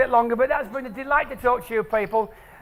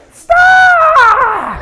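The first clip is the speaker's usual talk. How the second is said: high, loud cry of star!; delighted shout; 13 dB louder than usual. Retold or imitated. Speaker voicing a child's cry in the talk. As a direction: shout it as a thrilled four-year-old.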